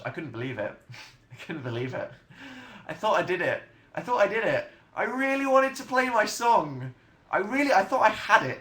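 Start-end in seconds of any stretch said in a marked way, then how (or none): 2.9-8.6 high, loud wail of disappointment; worked-up, high-pitched complaint; exasperated protest. Real, not acted. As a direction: protest as it climbs higher and louder. Protesting his failed song challenge.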